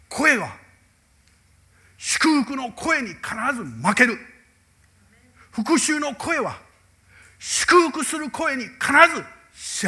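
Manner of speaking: slow, loud, high pulpit declaration; preaching at full force. Acted. Pastor proclaims scripture's promise to the congregation, loud and slow. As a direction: proclaim it slowly over the congregation.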